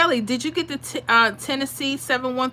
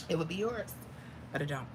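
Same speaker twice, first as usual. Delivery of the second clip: soft, lower, regretful admission; sincere regret; 4 semitones lower, 14 dB quieter, faster than usual. Real, not acted. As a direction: drop to a hushed apology. Sincere apology to viewer.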